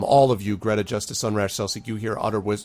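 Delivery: ordinary delivery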